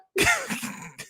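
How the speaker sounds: very high falsetto laugh falling away; high squealing laugh. Real, not acted. After shriek.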